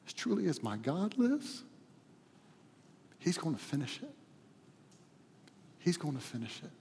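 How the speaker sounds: soft, intimate voice with long pauses; hushed reflection. Real, not acted. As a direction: hold on to the hope quietly. Hushed personal reflection after climax.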